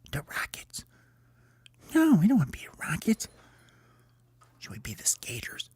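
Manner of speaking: hushed team debate; soft whispered argument. Acted. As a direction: whisper back and forth in a huddle. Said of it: "they go over and they whisper".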